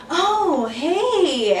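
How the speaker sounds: high, drawn-out delighted hello; sweetly mocking happy voice; happy greeting. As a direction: imitate a girl thrilled by the call. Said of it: "she sounded so happy".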